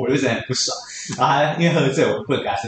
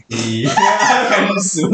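laughing through; 4 semitones higher, 3 dB louder, much slower than usual. Retold or imitated. Retelling boyfriend's request.

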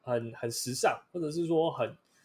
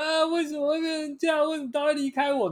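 much higher hammed-up sob; mock sobbing; 11 semitones higher than usual. Heartbroken customer crying to her friends, mock sob. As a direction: overplay the heartbreak. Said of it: "爆哭".

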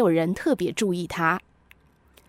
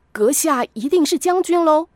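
higher, eager subordinate; standing to attention; 6 semitones higher than usual. A narrator's reading. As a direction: snap to attention and guess. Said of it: "少校马上立正说". (立正恭敬地说)

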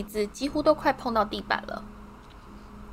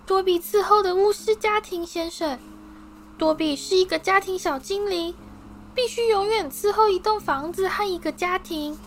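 eager house-elf Dobby; 10 semitones higher than usual. A narrator's reading. Dobby thrilled to finally meet Harry, high squeaky voice. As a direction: squeak it out, overjoyed.

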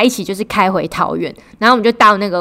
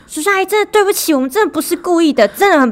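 fast, higher-pitched groveling apology; pleading apology; 9 semitones higher than usual. Retold or imitated. Young driver begs the car owners to forgive her, in a fast pleading apology. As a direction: beg forgiveness like a caught kid. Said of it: "立刻就是道歉".

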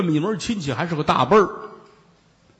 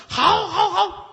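eager agreement; slower than usual. Acted. Magistrate eager to meet monk.

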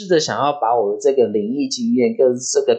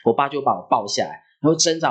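normal talking voice

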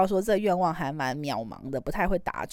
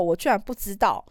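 ordinary talk, nothing marked

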